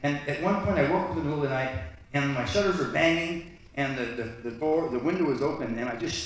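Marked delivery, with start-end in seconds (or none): none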